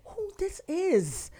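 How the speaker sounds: high, drawn-out puzzled question; exaggerated slow puzzlement; mock bewilderment. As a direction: stretch it out high, baffled by a random video. Acting reaction to random videos.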